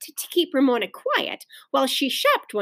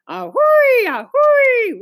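high, loud locomotive whistle; child's high wailing train imitation; 13 semitones higher, 6 dB louder, much slower than usual. A narrator's reading. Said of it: "wailed Ramona".

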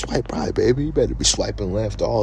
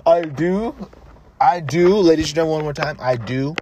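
mock vow; 8 semitones higher, 5 dB louder than usual. Mock wedding vow, announced.

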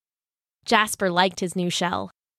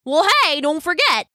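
higher and louder, eager interjection; excited friend cutting in; 7 semitones higher, 3 dB louder than usual. A narrator's reading. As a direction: jump in with bright enthusiasm. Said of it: "said Brock excitedly".

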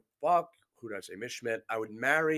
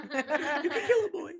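mocking; slower than usual. Mocking a friend's suggestion.

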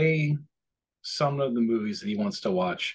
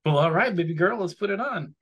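indulgent dad; 7 semitones higher than usual. Retold or imitated.

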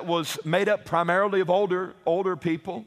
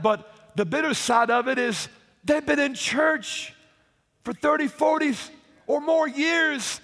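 fiery preaching; 3 semitones higher than usual. Acted. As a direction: preach it hard to the congregation. Preaching climax to the congregation.

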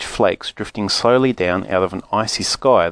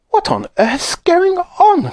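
put-on high, slow, cracked old woman's cry; startled old grandma; 3 dB louder, slower than usual. Grandma waking to the laughter, high startled old woman's cry. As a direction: wake up startled and shrill. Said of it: "cried Grandma Josephine".

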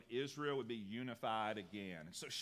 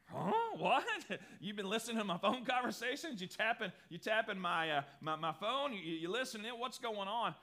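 bewildered; 6 semitones higher, 3 dB louder than usual. Retold or imitated. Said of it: "And you'd be like".

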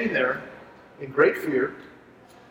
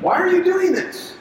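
God's rebuke; 11 semitones higher than usual. Acted. God rebuking Moses, acted.

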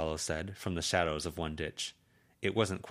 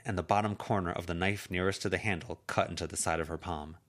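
usual speaking voice

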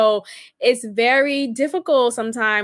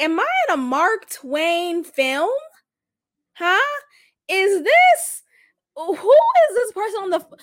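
baffled reaction; 7 semitones higher than usual. Retold or imitated. Woman baffled by her caller's country voice, disbelieving. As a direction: act out being thrown by the voice.

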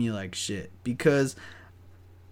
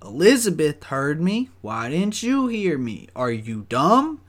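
retold indignant retort; 5 semitones higher, 4 dB louder than usual. Dog walker defending his beep to coworker, acted indignation. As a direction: insist loudly, acting out exasperation. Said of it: "And I said".

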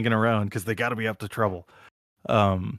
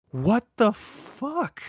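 high, drawn-out mock bafflement; stunned disbelief; 12 semitones higher, much slower than usual. Onlookers as Wayne breaks another window, stunned. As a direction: stretch it out slowly, utterly dumbfounded, pitched high. Everybody reacting to second broken window.

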